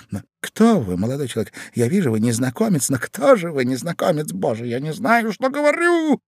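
babbling questions rising to a wail; flustered Ivan. A narrator's reading. Ivan asking the stranger who he is, flustered. Ivan frantic to learn identity.

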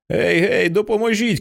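high desperate call for help. Acted. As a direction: shout to the far figures for help. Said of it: "кричить Джоанна". (високий відчайдушний крик про допомогу)

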